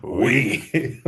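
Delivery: hammy French "oui"; mock French accent. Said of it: "offensive French accents today".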